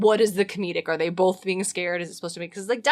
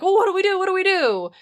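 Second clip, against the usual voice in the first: high, loud panicky flapping; mock panic; 10 semitones higher, 4 dB louder, faster than usual. Retelling the play, Dionysus panicking over what to do. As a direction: squeal it out like a fool in a fright.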